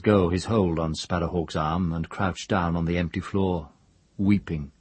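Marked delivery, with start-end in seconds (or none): none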